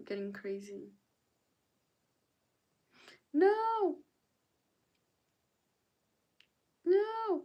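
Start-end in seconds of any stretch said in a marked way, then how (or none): 3.3-7.4 high squealed no. Real, not acted. Streamer reacting to chat.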